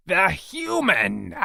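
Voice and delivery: high, loud, slow demand; angry alien captor. A narrator's reading. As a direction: stretch it out as an impatient creature.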